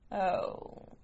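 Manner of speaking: ordinary delivery, nothing marked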